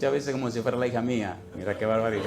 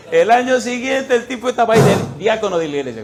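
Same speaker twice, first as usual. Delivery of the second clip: excited climax; 8 semitones higher, 9 dB louder than usual. Real, not acted. Triumphant climax of story.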